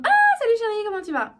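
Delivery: high, loud gushing hello in head voice; head voice greeting. Shown as a demonstration. Coach acting out greeting a darling, high head voice. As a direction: squeal a hello up in head voice, then ease down. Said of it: "j'ai utilisé la voix de tête".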